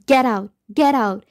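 mild narrated scream in separate bursts; restrained scream. A narrator's reading. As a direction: scream it, but held back for narration. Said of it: "as I scream".

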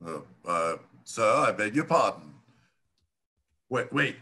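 ordinary talk

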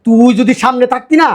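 high, heated outburst down the phone; furious, threatening scolding; angry threat. Acted. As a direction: tear into him for answering back. Threatens son-in-law on phone.

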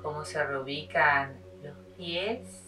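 measured meditation voice with long pauses; unhurried yoga teacher leading a body scan; guided meditation. Acted.

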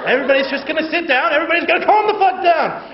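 loud, high yelling at the crowd; furious shouting. Acted. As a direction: scream orders like a cop losing control. Cop screaming at crowd.

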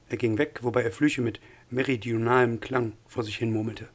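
usual speaking voice

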